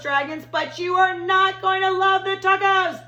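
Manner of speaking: put-on high, loud pleading boy's voice; boy shouting a warning. Little boy pleading with the dragons not to eat, shouting high. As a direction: warn the dragons desperately. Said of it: "He tries to stop him".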